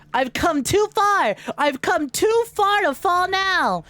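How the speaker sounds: dramatic hero. Acted. Dramatic speech while downed.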